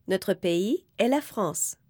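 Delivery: ordinary talk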